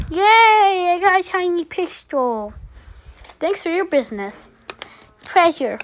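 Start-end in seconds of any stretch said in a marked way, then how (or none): 0.1-2.5 excited. Acted. Child thrilled with a toy.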